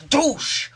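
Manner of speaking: high, explosive impact noise; mimicked elbow blow. Vlogger acting out a footballer's elbow to the face, explosive impact noise. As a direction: burst it out like a blow landing.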